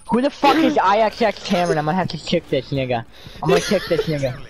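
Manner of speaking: high, loud put-on rage with laughs; mock fury at another player; angry. Raging at an enemy player.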